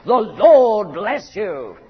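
slow, loud pronounced blessing; solemn blessing. Shown as a demonstration. Preacher pronouncing the priestly blessing slowly and loudly. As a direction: pronounce it slowly over the people, full voice.

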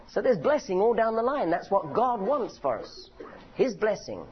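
ordinary talk, nothing marked

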